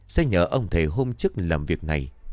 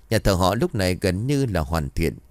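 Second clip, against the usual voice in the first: usual speaking voice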